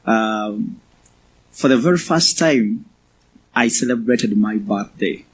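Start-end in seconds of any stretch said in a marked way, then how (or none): none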